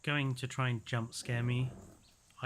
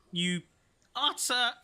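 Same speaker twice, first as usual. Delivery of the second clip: angry; 7 semitones higher, 5 dB louder, slower than usual. Real, not acted. Attacked by dinosaur.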